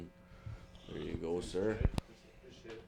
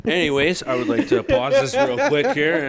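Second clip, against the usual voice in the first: higher and louder, still giggling through the words; laughing; 6 semitones higher, 8 dB louder than usual. Real, not acted. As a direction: try to get back on track while still laughing. Still laughing while resetting.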